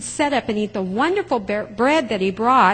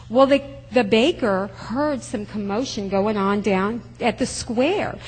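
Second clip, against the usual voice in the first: ordinary delivery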